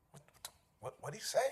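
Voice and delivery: barely voiced, confused what what what; hushed mock bafflement. Confused listener hearing a fake word, hushed and baffled. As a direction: mutter it like a puzzled onlooker.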